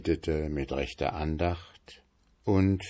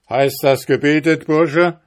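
high, loud enraged shout; the innkeeper raging at the whistle; furious yell; 8 semitones higher, 9 dB louder than usual. A narrator's reading. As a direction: scream it in fury. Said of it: "schrie der Wirt voller Wut".

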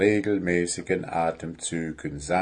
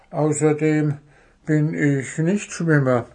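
mildly protesting, higher voice; 6 semitones higher than usual. A narrator's reading. Goblin admits he is no swimmer, protesting. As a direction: add a reluctant objection. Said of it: "protestierte Wuschel".

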